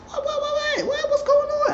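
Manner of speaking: very high squeaky woman's voice, rattling off questions; panicked falsetto woman. Retold or imitated. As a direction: pile up the whats in a high falsetto.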